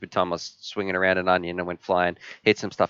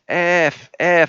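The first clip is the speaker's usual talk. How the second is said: higher, louder mock pain yelp; retold pained swearing; 8 semitones higher, 4 dB louder than usual. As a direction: act out cursing from the jellyfish sting, half-stifled. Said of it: "I'm saying to the kids".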